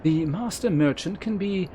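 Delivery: measured, ceremonious quest-giver voice; stately guild elder. Acted. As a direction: instruct a new adventurer like a gracious mentor.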